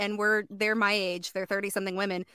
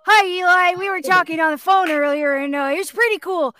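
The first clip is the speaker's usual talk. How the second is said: put-on chatty young boy; 3 semitones higher than usual. Shown as a demonstration. Boy telling Eli about a phone chat, boy voice. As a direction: talk like a lively boy. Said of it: "We gotta hear the boy voice".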